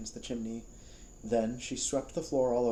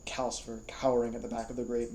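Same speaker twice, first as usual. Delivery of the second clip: plain reading voice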